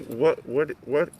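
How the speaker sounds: usual speaking voice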